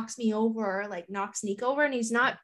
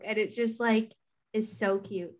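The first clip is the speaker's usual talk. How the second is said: ordinary talk, nothing marked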